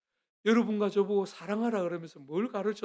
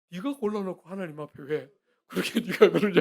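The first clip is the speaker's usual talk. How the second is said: loud retort; 9 dB louder than usual. Retold or imitated. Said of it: "아내한테 그런 이야기 했거든요".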